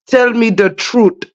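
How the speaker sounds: playful put-on Indian accent, hard t's; mock Indian accent. Host jokingly grilling Miss Orly, mock Indian accent. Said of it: "Everybody doing Indian voices".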